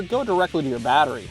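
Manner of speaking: normal talking voice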